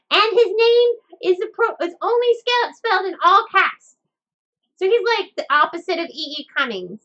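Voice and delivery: high, loud puppet explaining. Acted. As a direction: chirp it like a puppet.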